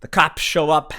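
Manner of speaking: usual speaking voice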